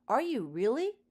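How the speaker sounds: bright, high eager question; delighted young girl asking; excited girl. A narrator's reading. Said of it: "in her Lily-sounding voice".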